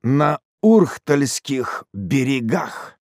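fierce triumphant harangue; savage gloating. Acted. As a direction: boast of bloody revenge, stretching the words. Hermit gloats over bloody hands.

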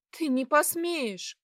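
high, drawn-out protest; frightened defiance. A narrator's reading. Girl defying the satyr who threatens her, frightened.

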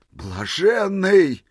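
high, long-drawn exclamation of delight; admiring cry. A narrator's reading. Old man admiring the holy fool, rapturous cry. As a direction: stretch the word out in rapture. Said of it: "восхищённо крикнул".

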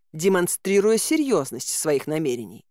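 ordinary delivery

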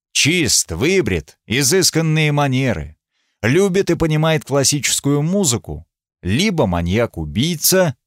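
ordinary talk

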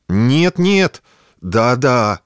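frightened, stammering yes and no; trembling babble. A narrator's reading. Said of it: "трясущимися губами пролепетал".

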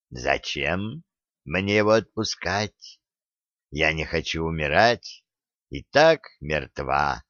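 whisper-taunt like a dying spider witch; sinister spider demon. Acted. Spider demon Umrun taunts Oleg.

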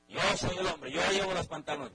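domineering father declaring he rules, loud; macho father. Acted. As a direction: puff up like the boss of the house.

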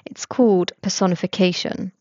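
ordinary delivery

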